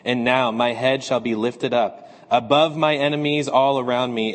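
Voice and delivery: ordinary talk, nothing marked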